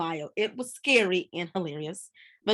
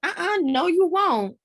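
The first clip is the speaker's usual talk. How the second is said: higher, louder playful protest; 8 semitones higher, 6 dB louder than usual. Acted. Friend stopping her pal from spilling secrets, in a loud mock protest. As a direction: shut her down fast, half laughing.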